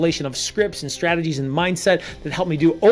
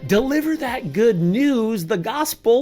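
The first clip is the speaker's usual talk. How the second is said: ordinary talk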